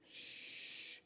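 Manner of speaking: long soft "shhh"; shush. Retold or imitated. Buddy silences the hunter, long shush. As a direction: hush him with a drawn-out shush.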